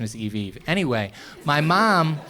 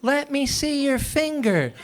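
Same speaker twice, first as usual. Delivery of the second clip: very high, slow frantic plea; hammed-up tearful mother; mock crying mom; 11 semitones higher, slower than usual. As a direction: play the panicked mom begging. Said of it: "screaming and crying".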